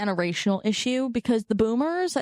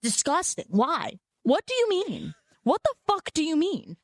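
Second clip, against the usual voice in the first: higher, baffled and disgusted; mock disgust at the astrology excuse; incredulous disgust; 6 semitones higher than usual. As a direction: scoff at the nonsense in disbelief. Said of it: "And I'm like".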